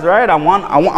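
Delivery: ordinary talk, nothing marked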